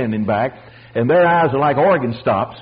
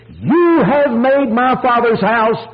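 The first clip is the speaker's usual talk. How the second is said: high, slow, drawn-out rebuke; retold shout; 8 semitones higher, 4 dB louder, slower than usual. Preacher quoting Christ's second purge, slow shout. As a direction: cry it out slowly, high in the voice. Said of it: "this time he shouted".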